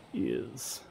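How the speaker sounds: quiet English-accented "Yes", vowel stretched towards "ears"; imitating Charles. Shown as a demonstration. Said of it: "he would be like".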